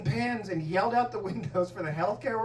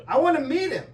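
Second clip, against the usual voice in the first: higher, louder eager ask; eager plea; 7 semitones higher, 6 dB louder than usual. Retold or imitated. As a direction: beg to meet him, keen and bright. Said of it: "like that".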